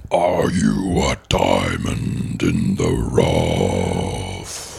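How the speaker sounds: very low, very slow whispered cave; grinding stone statue. Acted. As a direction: breathe it out like a giant cave. Plays the Cave of Wonders.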